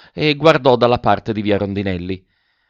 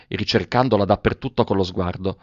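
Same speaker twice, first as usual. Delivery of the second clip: ordinary delivery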